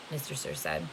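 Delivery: usual speaking voice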